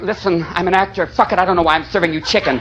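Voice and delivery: quiet low jaded waiter voice, a light touch; waiter actor. Retold or imitated. Hollywood waiter grumbling to a diner, lightly voiced. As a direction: mutter it like a bored actor waiting tables. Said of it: "all the waiters in Hollywood are going".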